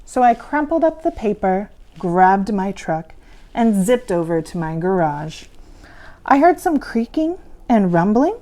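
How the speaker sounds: usual speaking voice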